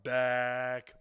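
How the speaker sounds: loud, drawn-out excited welcome; big announcer-style comeback greeting; hyped show opening. Real, not acted. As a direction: stretch it out and belt it to the audience.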